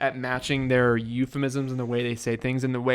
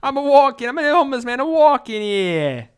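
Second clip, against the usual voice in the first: hammed-up Italian accent; 12 semitones higher, 5 dB louder than usual. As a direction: shout as a comic Italian street man. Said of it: "a homeless Italian man came up".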